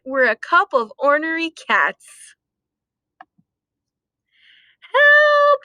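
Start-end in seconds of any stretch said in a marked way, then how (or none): 4.8-5.6 high, loud yell; yell for help. Acted. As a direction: cry out in panic. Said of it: "cried the cats".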